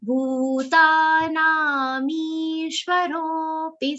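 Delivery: "Bhutanamishwaropi" chanted slowly, "ro" held long. Shown as a demonstration. Teacher guiding long vowels in Gita chanting. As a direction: stretch the "ro", then the avagraha. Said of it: "ro is a long sound followed by an avagra".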